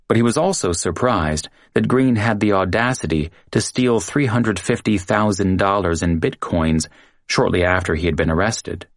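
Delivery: ordinary delivery, nothing marked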